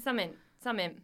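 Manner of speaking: "something" reduced to "summin", softly; swallowed ending. Shown as a demonstration. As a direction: drop the middle and final g of "something", low-key. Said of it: "I said something".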